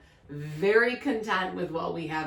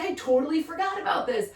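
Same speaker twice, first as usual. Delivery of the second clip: mock panic; 9 semitones higher than usual. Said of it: "I was like".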